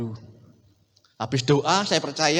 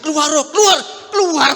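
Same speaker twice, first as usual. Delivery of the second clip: loud, raised re-enacted command; exorcism command; 7 semitones higher, 8 dB louder than usual. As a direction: order the demon out forcefully. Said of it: "Kita ngomong".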